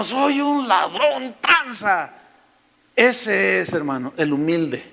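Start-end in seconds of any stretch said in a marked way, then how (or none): 0.0-2.1 confesión aguda y estirada; Zaqueo confesando. Acted. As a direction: confiesa lento, con dramatismo fingido. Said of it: "Y el Zaqueo". (confesión exagerada y lenta)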